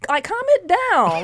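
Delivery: high, slow calm-it-down; mock calming. Mother urging the crying father to calm down. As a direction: stretch it out, soothing.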